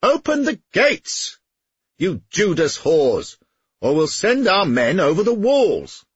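slow, high, furious bellow. A narrator's reading. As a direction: roar it up at the walls. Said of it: "He stood in his stirrups and bellowed".

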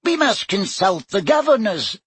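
high squeaky professor voice; a tiny wizard squeaking up; squeaky little voice. A narrator's reading. Flitwick to the staff, squeaky little voice. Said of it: "in his squeaky little voice".

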